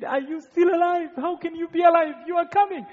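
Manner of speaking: overjoyed disbelief at his return; amazed disbelief. Retold or imitated. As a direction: friends who thought him dead, stunned to see him. Friends see him return alive.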